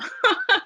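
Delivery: sudden high laugh; burst of laughter. Real, not acted. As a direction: laugh out loud. Laughs at her story title.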